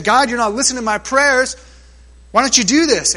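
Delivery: frustrated prayer. Acted. Voicing Habakkuk.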